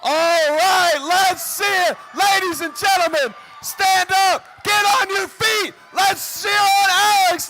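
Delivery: loud arena announcer shouting; hype announcer. Acted. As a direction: get the crowd on its feet. Announces Alex, crowd on its feet.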